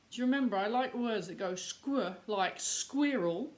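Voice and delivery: ordinary delivery, nothing marked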